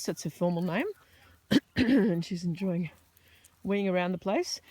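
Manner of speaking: usual speaking voice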